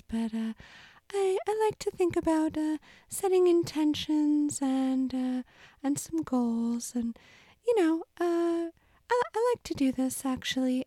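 very high, squeaky little mouse; chirpy tiny mouse character. Acted. Mouse character keeps talking.

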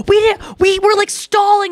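high-pitched, giddy retelling; so excited. Retold or imitated. Girls in on the surprise telling her they stalled, excited. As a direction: imitate excited girls gushing. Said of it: "like, so excited".

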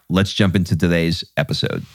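usual speaking voice

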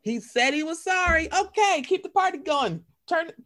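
high, breezy, moving on fast; dismissive party cheer. Retold or imitated. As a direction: brush it off and party. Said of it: "They're like".

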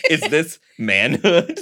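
giggling through a slow question; laughing. Acted. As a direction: laugh while asking it.

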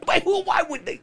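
very high, sputtering frustration; hammed-up mad, voice shooting high; mock exasperation. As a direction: sputter in comic disbelief. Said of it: "you get so mad".

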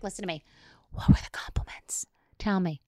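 urgent whispered demand; intense hushed interrogation. Retold or imitated. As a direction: drop to a whisper and press for answers. Acted intense interrogation of daughter.

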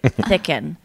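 P=A; lisped chicken. Retold or imitated. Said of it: "And he's like".

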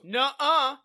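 bickering imitated nuh-uh; mock denial. Acts out childish back-and-forth denial.

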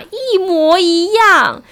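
each syllable of 一模一样 stretched very slowly and pressed; drawn-out emphasis. Real, not acted. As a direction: spell out 一模一样 slowly for shock. Shocked reveal of matching number.

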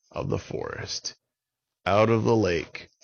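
plain reading voice

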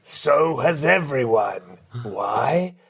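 slow, booming host announcing the afterlife; a grand gatekeeper between heaven and hell, drawn out; Judgment Day host. Acted. Podcast host greets guest as Judgment Day judge, booming and slow.

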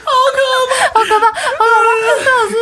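high, cracking laugh-cries; a real giggling squeal of embarrassment; laughing in horror. Real, not acted. Laughing at her own forgetfulness.

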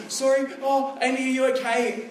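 a hint of a panicked nine-year-old; imitating worried son. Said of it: "Nathaniel's going".

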